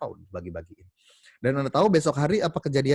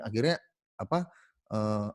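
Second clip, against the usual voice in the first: usual speaking voice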